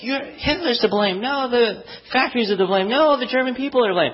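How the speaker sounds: high, quick crowd voices shouting over each other; blaming crowd. Retold or imitated. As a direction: throw the blame around like a panicked crowd. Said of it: "They're all like".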